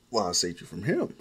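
ordinary delivery, nothing marked

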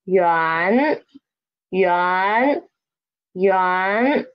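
yuan starting low and rising; second tone. Shown as a demonstration. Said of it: "the tone is second tone".

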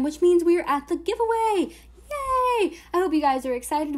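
high, loud cheering; excited. Real, not acted. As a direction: cheer for the giveaway. Cheering the giveaway.

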